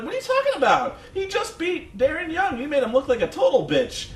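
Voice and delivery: very high mock protest; indignant colleagues. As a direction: imitate incredulous co-commentators arguing back. Colleagues arguing back, acted.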